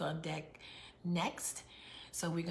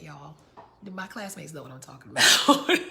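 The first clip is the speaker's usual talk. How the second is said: normal talking voice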